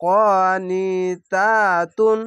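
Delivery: কনিতাতুন drawn out very slowly, syllables held long; extra-slow chanted কনিতাতুন; slowed recitation. Shown as a demonstration. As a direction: stretch কনিতাতুন out for the learner. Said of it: "slow করে পড়ছি".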